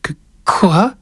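put-on a scared little boy stammering; frightened boy. A narrator's reading. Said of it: "la voix un peu vibrante". (petit garçon apeuré qui bégaie)

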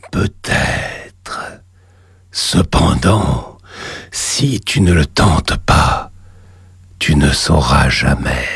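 deep, slow, echoing demon god; cavernous voice. Acted. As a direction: boom it up from the lava below. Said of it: "une voix caverneuse".

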